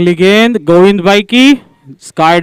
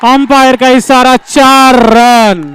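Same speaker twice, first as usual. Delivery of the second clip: slow, drawn-out call of four runs; stretched boundary announcement; 4 semitones higher, slower than usual. Real, not acted. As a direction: stretch out each word of the call.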